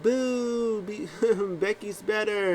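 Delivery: put-on high little girl voice, booing; kid fan booing. Little fan heckling Liv, high child voice. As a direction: play a tiny fan heckling.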